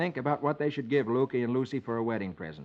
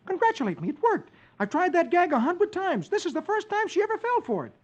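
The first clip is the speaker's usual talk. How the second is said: high, smug whispered-to-the-dog glee; gleeful Dagwood. Acted. Dagwood brags to his dog, high gleeful voice. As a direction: chuckle it to the dog. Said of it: "says with a smile".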